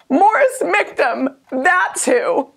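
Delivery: high, slow proud announcement; grand reveal. Pretend. Mom proudly answering her son, high and slow. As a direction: unveil the name with pride.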